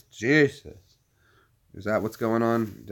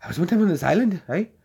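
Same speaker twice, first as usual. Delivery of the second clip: put-on higher, louder Saipan islander accent; Lenizo's island accent; 8 semitones higher, 5 dB louder than usual. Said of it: "he had this cool accent".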